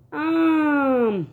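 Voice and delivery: "آم" with the آ held very long; long drawn آ sound like a teacher. Shown as a demonstration. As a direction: stretch the آ in "آم" slowly. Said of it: "اس کی آواز تھوڑی کھچ کے آتی ہے".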